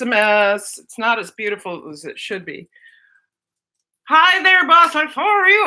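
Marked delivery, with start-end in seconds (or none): 4.1-5.7 high, loud cheery greeting; a louder, higher hello to a viewer named Boss Life; bright greeting. Real, not acted. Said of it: "I was talking like this".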